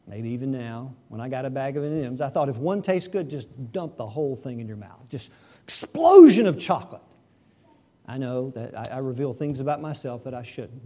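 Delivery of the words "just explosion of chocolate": gleeful burst, louder and higher; kid's delight at a mouthful of chocolate; excited. Acted. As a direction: explosion punched like a kid with candy. Kid gobbling whole bag of candy.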